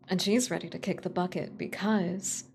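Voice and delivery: ordinary delivery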